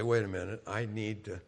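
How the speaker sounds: soft, low, self-calming apology; acted subdued plea for a moment; calling a timeout. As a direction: back off quietly from an argument. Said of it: "just call a timeout and say".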